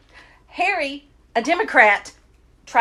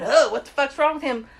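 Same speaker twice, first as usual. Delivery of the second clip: ordinary delivery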